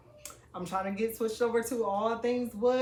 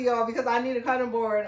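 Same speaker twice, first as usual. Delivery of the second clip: ordinary delivery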